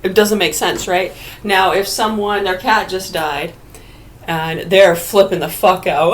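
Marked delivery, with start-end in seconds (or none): none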